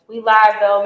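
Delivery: loud chanted "We live, though"; loud hype call; hype shout. Acted.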